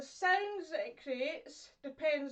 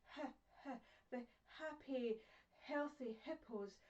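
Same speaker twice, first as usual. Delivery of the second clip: much softer "happy, healthy hippos"; softly spoken; 8 dB quieter than usual. Shown as a demonstration. Teacher showing soft sounds, gentle and soft. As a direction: let the h's and l's breathe out gently. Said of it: "it's all kind of softly spoken".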